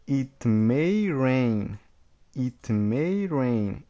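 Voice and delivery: very slow, word by word: It may rain; slow model reading. Shown as a demonstration. Teacher drilling students slowly. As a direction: spell it out slowly for the class. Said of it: "vamos lá, ó".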